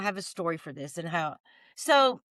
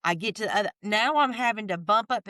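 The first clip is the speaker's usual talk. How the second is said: ordinary delivery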